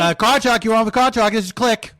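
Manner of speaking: car radio host. Acted. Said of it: "I'm the host of the show".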